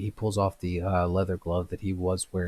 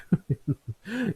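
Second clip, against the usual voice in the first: chuckle; 12 semitones higher than usual. Acted. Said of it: "Ember kinda chuckles".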